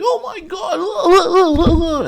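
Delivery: very high, loud, stretched-out "oh my God", laughing through it; slow, shrieking mock despair; mock wailing player. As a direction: ham up a flopping player's dramatic wail. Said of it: "He's like".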